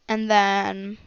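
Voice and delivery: ordinary delivery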